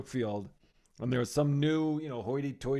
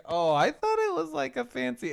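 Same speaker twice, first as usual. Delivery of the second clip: mock naive voice; 11 semitones higher than usual. Retelling wife's surprised quote.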